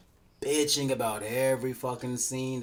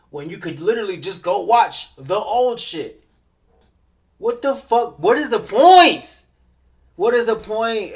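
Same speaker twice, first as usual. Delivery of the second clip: louder, higher exasperated rant; real frustrated yelling; angry rant; 7 semitones higher, 12 dB louder than usual. Real, not acted. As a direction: blow up at the fans. Ranting angrily at fans.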